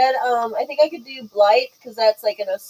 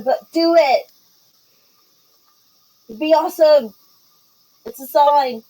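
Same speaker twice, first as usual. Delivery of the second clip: raised, higher-pitched calling voice; yelled encouragement; 5 semitones higher, 3 dB louder than usual. Acted. Pippa urges scared Wendelin from afar, yelling encouragement. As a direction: urge a friend on, calling over the distance. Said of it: "so Pippa's yelled that".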